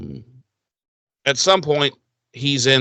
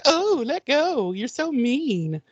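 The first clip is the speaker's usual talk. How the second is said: put-on high, slow, whiny little girl; 12 semitones higher, 4 dB quieter, slower than usual. Captured girl whines at the goddess, high and slow. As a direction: whine it like a pouting child. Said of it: "And Dawn's like".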